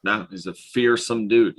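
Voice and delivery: usual speaking voice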